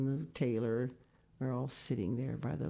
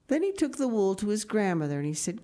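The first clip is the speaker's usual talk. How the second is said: bright, lifted read-aloud storytelling; storybook narration; 6 semitones higher, 4 dB louder than usual. A narrator's reading. Reading a picture book aloud to children, lifted storytelling. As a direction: tell it like a bedtime story to the kids.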